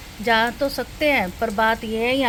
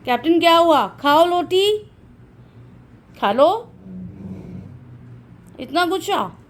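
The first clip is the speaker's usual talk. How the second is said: high, loud sing-song pet talk; coaxing the dog; 6 semitones higher, 4 dB louder than usual. Real, not acted. Mother coaxing her dog Captain to eat his roti in high pet talk.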